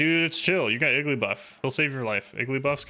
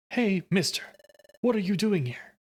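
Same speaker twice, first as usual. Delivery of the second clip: put-on higher little-kid voice; higher child asking mister; 7 semitones higher than usual. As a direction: talk like a curious small child. Voicing a kid character.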